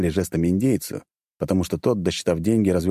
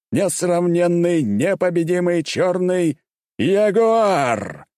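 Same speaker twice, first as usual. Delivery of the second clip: arena announcer; 11 semitones higher, slower than usual. A narrator's reading. Announcer hyping the champion to the arena crowd. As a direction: boom it out to the crowd, stretched.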